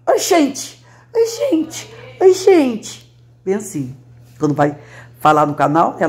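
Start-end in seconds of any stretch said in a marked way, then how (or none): 0.1-3.1 high, sing-song "Oi, gente" again and again; imitating Bahian greeting. Woman imitating her São Paulo friend's new Bahian hello. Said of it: "Até a Lula de São Paulo já aprendeu".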